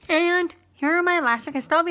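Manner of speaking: speaking with finger in mouth; finger pulling lip down. Real, not acted. Seen 0: Finger pulls lower lip down, showing braces, while she speaks.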